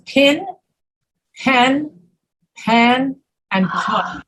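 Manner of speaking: pin, pen, pan, pun each set apart with its own vowel; careful model reading of pin, pen, pan, pun; distinct vowels. Shown as a demonstration. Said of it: "I could hear the distinct vowel".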